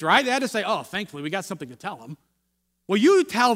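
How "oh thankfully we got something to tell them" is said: low, soft sigh of relief; relieved aside, dropping in pitch and volume; acted relief. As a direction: let the worry drain away quietly. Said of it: "they had to be relieved".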